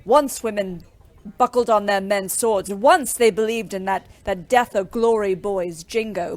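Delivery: normal talking voice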